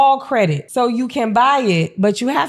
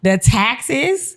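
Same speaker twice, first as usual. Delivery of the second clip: ordinary talk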